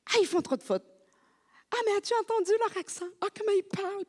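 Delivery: high, fast, sneering complaint; French compatriots sneering at the Quebec accent, mocking high voice; snooty mockery. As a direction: mimic snobbish French critics. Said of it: "ils critiquaient tout".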